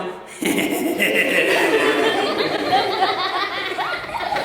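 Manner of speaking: long high mock giggle; drawn-out, mischievous put-on laughter; gleeful inner cackle. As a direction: cackle like a delighted tormentor. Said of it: "inside is going".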